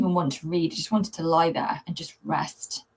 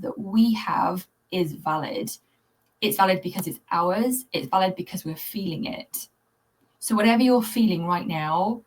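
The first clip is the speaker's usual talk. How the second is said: ordinary talk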